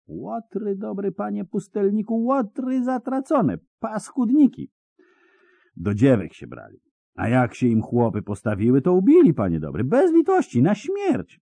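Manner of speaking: high, rustic peasant voice full of dread; a scared villager pouring out his news; frightened peasant. Acted. Peasant to hermit about the Dundar bandits, high and fearful.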